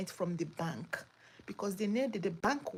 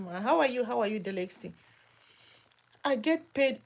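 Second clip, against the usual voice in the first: ordinary delivery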